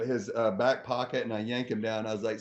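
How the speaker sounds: normal talking voice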